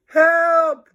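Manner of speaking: very high, loud yell; panicked cry; scream for help. Acted. Gym teacher being eaten.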